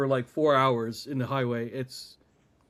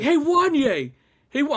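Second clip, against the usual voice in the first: excited hollering; 11 semitones higher, 7 dB louder than usual. Retold or imitated. Friend chasing a singer through the store, hollering excitedly. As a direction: imitate a fan's high, loud call.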